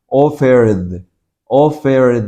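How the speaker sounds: "Offered" ending on a held, voiced d. Shown as a demonstration. Teacher models the ending for learners, "Offered" with a clearly voiced d. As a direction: finish "Offered" with a buzzing d, "offerd". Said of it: "se pronuncia como una D".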